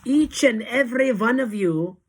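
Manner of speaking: high, loud command; Grand High Witch thundering. A narrator's reading. Witch leader addressing her followers, thundering command. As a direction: thunder it at the whole room. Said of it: "thundered the Grand High Witch".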